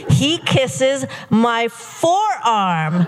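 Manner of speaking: giddy, melodramatic storytelling rising in pitch; mounting swoon. Retold or imitated. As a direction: stretch the words as the thrill mounts. Mock-swooning build of the kisses.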